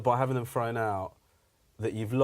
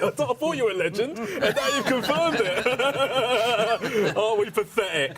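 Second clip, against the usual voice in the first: high, loud jeering lad voice, laughing; crowing mate in the front row; mocking laddish mates; 11 semitones higher, 5 dB louder than usual. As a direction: lay into the comedian like a gloating mate. Comedian mimics gloating heckler mates.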